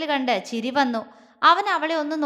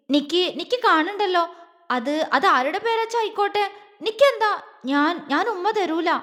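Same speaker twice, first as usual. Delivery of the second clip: higher, stumbling over the words; flustered stammer; 5 semitones higher than usual. Acted. Girl flustered refusing her husband's teasing, stammering higher. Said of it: "എങ്ങനെയൊക്കെയോ പറഞ്ഞൊപ്പിച്ചു".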